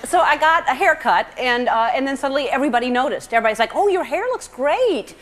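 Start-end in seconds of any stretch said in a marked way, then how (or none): 3.7-5.1 high, drawn-out mock gush; slow, swooping high praise; gushing compliment. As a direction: imitate a friend over-praising, stretched out. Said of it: "they go overboard with it".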